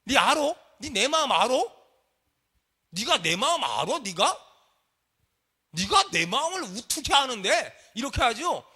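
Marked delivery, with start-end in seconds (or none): 0.1-4.4 loud, indignant mock challenge; acted angry questioning; irritated retort. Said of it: "성질 만나요". 5.7-7.8 drawn-out acted outburst; irritated retort. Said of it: "성질 만나요".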